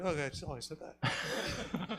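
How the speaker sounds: ordinary talk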